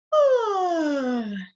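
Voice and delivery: long high sigh of boredom, falling; loud drawn-out bored sigh. Retold or imitated. Bored kid sighing.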